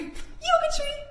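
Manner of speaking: high, drawn-out sing call; game call. Acted. Teacher springs the game call on the kids, high. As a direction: pop up high on it.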